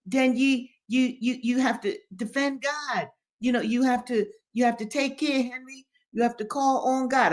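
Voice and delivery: put-on high-pitched, stammering old man; anxious Daddy Joe. A narrator's reading. Old man begs Henry to call on God, anxious exhorting. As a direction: plead urgently in a high voice, tripping on the first words.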